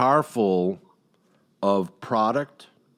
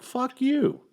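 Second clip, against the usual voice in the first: high mock defiance; 14 semitones higher than usual. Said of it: "I'm like".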